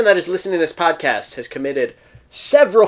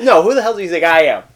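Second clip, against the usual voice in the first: mock outrage; 4 semitones higher, 7 dB louder, faster than usual. Mock offended at friend.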